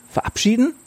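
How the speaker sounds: sharp high rising question; surprised. Acted. The girls hear the boy is leaving, surprised high rise. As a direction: react in disbelief.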